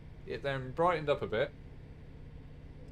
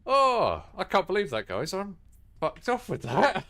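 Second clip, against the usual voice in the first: loud, high groan of annoyance; exasperated; 9 semitones higher, 7 dB louder than usual. Real, not acted. Train driver fed up with a missed stop, exasperated. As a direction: vent the frustration loudly.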